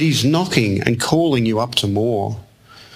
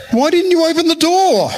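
very high-pitched mock outcry; exclaimed reproach; 4 dB louder than usual. Jane hears the story over the phone, mock incredulous outcry. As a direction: imitate an incredulous outburst, far above usual pitch. Retold wife repeats challenge.

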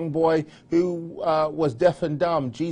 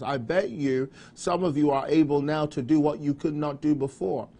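normal talking voice

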